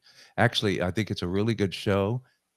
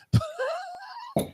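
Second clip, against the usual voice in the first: high squealing laugh; 3 dB quieter than usual. Real, not acted. Laughs after his own joke.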